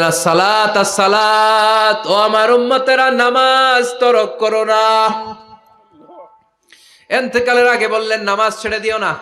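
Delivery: high, loud, drawn-out preaching cry; impassioned plea. Acted. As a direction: chant the last command with full force. Cries out the final plea.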